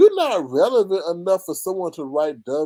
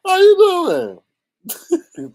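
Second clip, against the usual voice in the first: drawn-out greeting; 14 semitones higher, 9 dB louder, much slower than usual. Real, not acted.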